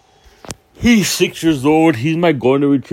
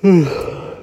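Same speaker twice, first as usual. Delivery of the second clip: sigh; 6 semitones higher than usual. Real, not acted. Exasperated pause about buying shoes.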